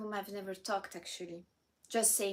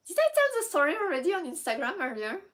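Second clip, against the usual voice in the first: sudden excited aside; 8 semitones higher, 7 dB louder, faster than usual. Real, not acted.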